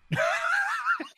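high shrieking burst of laughter; laugh-scream in disbelief. Real, not acted. Locked out of the bank in the game, shrieking laugh.

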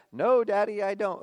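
a hint of a little boy answering his dad; son's reply.

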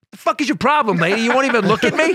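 loud, high-pitched outburst; snapping in anger. Retold or imitated. Comic snapping at a woman in the front row, angry outburst. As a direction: suddenly explode at a heckled target. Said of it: "they just see me snap on this woman".

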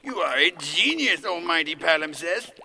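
loud theatrical homage; hammed-up courtly praise; grandiose flattery. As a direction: hail the master with florid reverence. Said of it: "Why am I talking like this".

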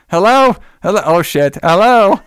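loud, high hellos with a dropped curse; acting out panicked calling into the receiver; calling down phone. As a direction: holler for an answer, curse in between. Sergeant calling down abandoned phone.

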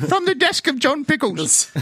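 high, showy announcer voice; mock announcer. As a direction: announce it like a grand title card. Announcer-style mock intro.

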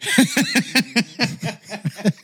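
real helpless laugh; burst of high laughter. Real, not acted. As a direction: crack up at the mix-up. Realizing wrong room.

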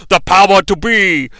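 booming heroic proclamation; epic hero shout. Pretend. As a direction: call it out like He-Man. Masters of the Universe epic call.

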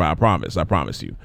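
dropping to a murmur; soft promise. Real, not acted. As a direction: trail off softly reassuring. Trailing soft reassurance.

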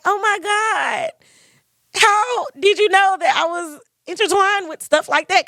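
high, astonished reaction; amazed retelling. Retold or imitated. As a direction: gasp it out like you can't believe it. Retelling her shock at being known.

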